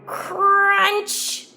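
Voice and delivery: ordinary delivery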